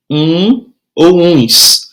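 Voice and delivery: um ou uns, drawn out slowly, each word held; teacher spelling out um ou uns slowly; slow demo of singular and plural. Shown as a demonstration. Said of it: "que é o caso de álbum e álbuns".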